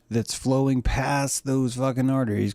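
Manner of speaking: ordinary talk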